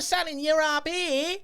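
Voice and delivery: very high, loud mock British accent; hammed-up British character, shrieky high; exaggerated British accent. Shown as a demonstration. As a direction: jump up into a squeaky British voice. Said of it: "Do a British accent".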